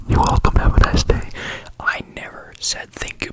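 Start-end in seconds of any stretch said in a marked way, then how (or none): 0.1-1.3 louder, punchy stage whisper of "You're welcome, have a nice day."; emphatic whisper. Retold or imitated. Cashier hammering the greeting at the customer, in a loud emphatic whisper. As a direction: push the whisper out hard, almost a shout.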